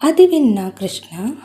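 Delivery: ordinary delivery, nothing marked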